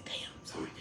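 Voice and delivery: breathy whisper, barely there; whispered apology. Real, not acted. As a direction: whisper it under your breath, embarrassed. Muttered apology after cursing.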